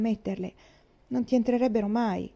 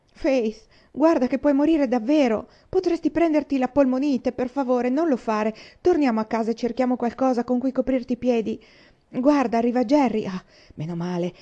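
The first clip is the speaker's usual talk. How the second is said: higher and louder, frantic begging; pleading alarm; 5 semitones higher, 5 dB louder than usual. Acted. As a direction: beg her desperately to stop.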